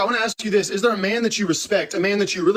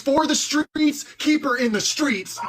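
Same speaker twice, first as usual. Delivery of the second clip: for the streets drawn out slow; slow emphatic; 4 semitones higher, slower than usual. Real, not acted. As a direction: stretch each word for the punchline. Punchline drawn out slowly.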